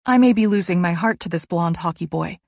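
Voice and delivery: plain reading voice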